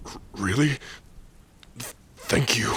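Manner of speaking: stunned joy spilling out; overjoyed stammer. Acted.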